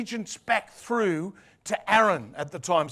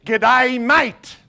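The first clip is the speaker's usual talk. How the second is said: louder, hearty Aussie "G'day, mate", pitched up; Australian greeting; 5 semitones higher, 8 dB louder than usual. Shown as a demonstration. Preacher showing visitors how to greet, hearty Australian. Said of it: "you can walk up to someone and say".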